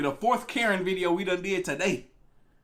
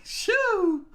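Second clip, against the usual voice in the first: high exclaim; 13 semitones higher than usual. Real, not acted. Streamer dreading the Mister Ballin video, a high yelp.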